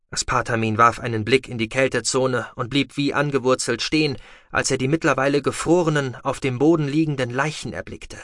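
ordinary delivery, nothing marked

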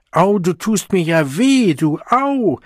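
high, loud yelp of protest; indignant, wailing ouch; pained outcry. Acted. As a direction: cry out as if someone is hurting you. Woman protests as she is hurt.

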